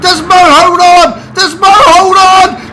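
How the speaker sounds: slow, desperate shout; frantic yell. Real, not acted. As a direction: yell it out, voice breaking. Repeated finish call.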